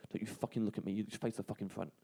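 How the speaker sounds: low, hushed menacing order; retold quiet threat. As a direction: imitate a thug threatening under his breath.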